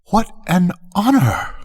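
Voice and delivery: high, thrilled courtiers' cry; cried courtiers. A narrator's reading. Courtiers praising the page's honor, high excited cry. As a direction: gush like flattering courtiers. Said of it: "cried all the courtiers".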